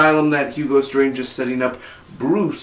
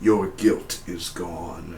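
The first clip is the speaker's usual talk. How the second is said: slow hypnotic God voice; 5 semitones lower, 7 dB quieter, much slower than usual. Retold or imitated. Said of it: "he's like".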